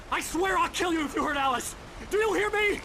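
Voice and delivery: high, loud shouted threat; furious threat. Acted. Writer threatening the kidnapper who took his wife, furious shout. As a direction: scream the threat after him.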